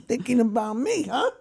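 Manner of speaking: laughing through a joking comeback; amused jab at himself. Retold or imitated. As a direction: chuckle it out, teasing. Joking back to trainer, laughing.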